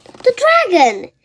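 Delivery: high, loud startled cry; sudden alarm. A narrator's reading. Girl remembering the missing dragon, startled exclamation. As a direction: blurt it out as it hits you.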